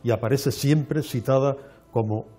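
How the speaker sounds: normal talking voice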